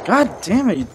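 ordinary talk

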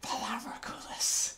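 high, slow whisper; whispered reply. Retold or imitated. Missandei answering Grey Worm in a high, slow whisper. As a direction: breathe the name out unvoiced. Missandei voiced in retold scene.

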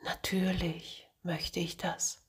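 soft, hushed and soothing; calm him down with a soft whisper. Acted. Angel soothes angry Martin, soft soothing whisper. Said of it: "Besänftigend antwortete er".

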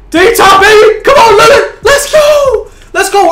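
very high, loud cracking yells; hype screaming. Real, not acted. Gamer cheering Lillard's clutch shot, cracking high screams. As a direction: scream with joy, voice breaking.

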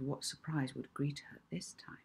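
hushed unvoiced narration; whispered. A narrator's reading. Narrator hushing over Molly's hoped-for surprise. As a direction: breathe it like a secret.

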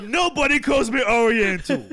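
high, loud protest with laughter around it; mock outrage. Friend joking about being called Oriental, loud mock outrage.

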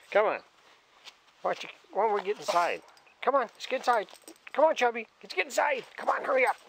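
high, coaxing pet voice calling him in; high coaxing call to the dog. Real, not acted. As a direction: chivvy the dog indoors, high and sing-song. Calling dog back inside.

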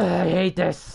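high, exasperated cry; frustrated outburst. Real, not acted. Fed up with the build, high frustrated outburst. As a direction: burst out with it as the groan breaks.

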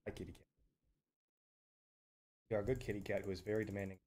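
soft, quick cat talk. Real, not acted. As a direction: coo at the demanding cat. Talking to his cat.

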